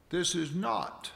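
slow phrase landing hard on "not"; stress on not. Shown as a demonstration. Preacher reading scripture to congregation, "not" drawn out and emphasized. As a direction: lean on "not", then stop. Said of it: "I emphasize the word not".

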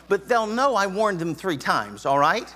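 ordinary talk

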